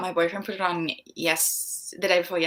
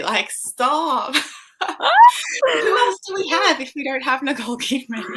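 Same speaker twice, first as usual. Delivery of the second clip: high, loud protest breaking into laughter; playful outrage; 8 semitones higher, 9 dB louder than usual. Real, not acted. Australian defending the country's one claim to fame, playfully outraged. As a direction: exclaim it in laughing disbelief, getting higher.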